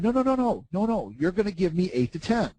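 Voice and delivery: high, emphatic no no no; mock stubborn insistence. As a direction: refuse the market like a spoiled kid. Arguing with the market.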